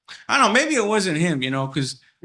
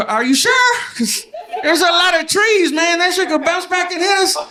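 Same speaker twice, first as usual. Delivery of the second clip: panicked shout; 8 dB louder than usual. Retold or imitated. [name] shouts his doubts about the trees, high and laughing. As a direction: yell your worry through laughter. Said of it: "And I was like".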